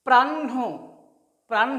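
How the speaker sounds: "প্রাণ" with held n and an added breath of ho; n before h. Shown as a demonstration. Teacher introducing the next word, প্রাণ as "prann-ho". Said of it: "আগে মূর্ধন্য উচ্চারণটা আসছে".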